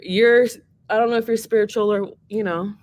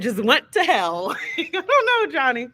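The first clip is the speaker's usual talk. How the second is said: breaking into real laughter, pitch jumping up; amused, laughing through the words; 6 semitones higher than usual. Real, not acted. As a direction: crack up while teasing a friend. Joking about a disastrous request.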